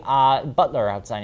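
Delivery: ordinary talk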